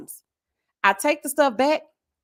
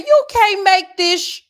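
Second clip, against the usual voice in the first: high, loud, slow disbelief; outraged exclamation; 9 semitones higher, 6 dB louder, slower than usual. Retold or imitated. Retelling her outburst at the overcharge, loud and high. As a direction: let the outrage burst out.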